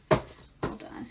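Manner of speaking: a quick whispered hold on; whispered aside. Real, not acted. Reader pausing the viewer while shuffling, whispered. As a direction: murmur it to yourself, unvoiced.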